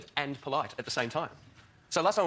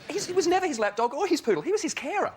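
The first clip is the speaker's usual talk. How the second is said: high excited punchline; 3 dB louder than usual. Real, not acted. Comedian building a Blair joke at a panel show, high and excited. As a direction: voice shoots way up on the punchline.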